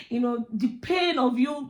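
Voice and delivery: ordinary talk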